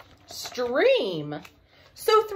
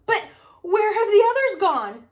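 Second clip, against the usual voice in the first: higher, louder worried cry; alarmed cry; 8 semitones higher, 7 dB louder than usual. Acted. Father bear finds the others gone, alarmed cry. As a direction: call out in sudden alarm. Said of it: "Daddy Bear cried".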